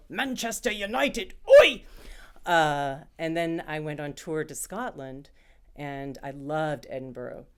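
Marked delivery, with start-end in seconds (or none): 0.1-1.8 high, loud Cockney football chant; Cockney accent. Retold or imitated. Actress showing off London dialects in a loud Cockney shout. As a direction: bellow it like a Cockney fan on the terraces. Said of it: "if you're Cockney".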